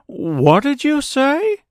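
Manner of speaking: high, slow scarecrow voice; questioning scarecrow. A narrator's reading. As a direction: ask in a high character voice. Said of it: "asked the Scarecrow".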